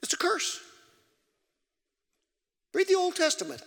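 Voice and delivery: high, loud pulpit preaching; preaching at full force. Acted. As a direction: preach it hard to the congregation.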